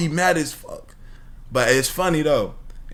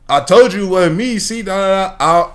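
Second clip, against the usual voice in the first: higher, louder imitation of a prankster; mock vindicated protest; 5 semitones higher, 5 dB louder than usual. Prankster to bystanders after someone else screams, mock vindicated protest. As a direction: protest as if just proved innocent. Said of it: "it don't sound as funny when I'm saying it".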